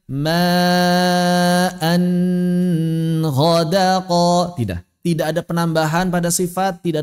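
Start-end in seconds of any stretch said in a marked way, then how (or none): 0.0-4.5 "ma'an ghadaqa" chanted with an overlong n; nun memanjang. Shown as a demonstration. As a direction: hold the n of "ma'an" far too long. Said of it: "Atau dibaca memanjang". ("ma'an ghadaqa" dengan nun ditahan panjang)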